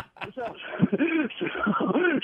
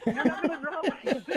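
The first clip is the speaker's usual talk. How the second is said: giggling and quieter; laughing, soft; 5 semitones higher, 9 dB quieter than usual. Real, not acted. Teller cracking up at the host's joke, soft laugh. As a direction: let it dissolve into laughter.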